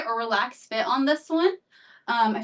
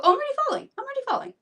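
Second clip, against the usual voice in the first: surprised; 13 semitones higher, much faster than usual. Real, not acted. Realises she already follows them.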